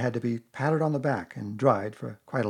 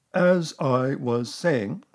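slow, loud, stern insistence; resume weightily over the interruption; 3 semitones higher, 7 dB louder, much slower than usual. A narrator's reading. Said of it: "said Eeyore loudly and sternly".

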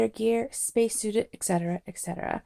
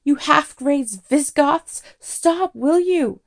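high, loud indignant bawl; 7 semitones higher, 8 dB louder, slower than usual. A narrator's reading. Arthur abusing the wreckers of his home, bawling. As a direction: hurl insults and demand they stop. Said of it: "bawled Arthur".

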